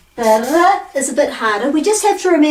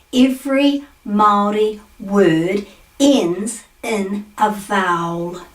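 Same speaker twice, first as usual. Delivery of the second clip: slow, word by word, dropping lower at the end; deliberate spacing between words; slow emphasis; 3 semitones lower, slower than usual. Real, not acted. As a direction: spell out the key fact slowly. Key fact announced with emphasis.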